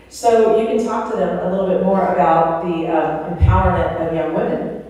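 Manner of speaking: ordinary delivery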